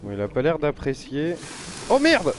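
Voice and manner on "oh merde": sudden high loud "oh merde"; startled. Real, not acted. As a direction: jolt of alarm at a nasty surprise. Sudden danger in game.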